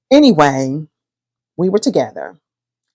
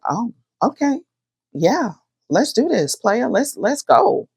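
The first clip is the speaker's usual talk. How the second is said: high, eager and thrilled; giddy yes with the voice way up; excited; 7 semitones higher than usual. Retold or imitated. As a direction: jump at the offer, pitched up high. Excited reply to ultimatum.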